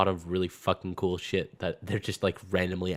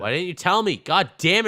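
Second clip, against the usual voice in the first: high, loud hammed-up indignation; exaggerated betrayed outburst; mock outrage; 10 semitones higher, 6 dB louder than usual. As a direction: scold your friend loudly in pretend fury.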